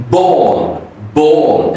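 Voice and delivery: "ball" with sharp B and long rounded aw; stop, release the B, stretch "ball"; plosive B. Shown as a demonstration. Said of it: "B is very plosive".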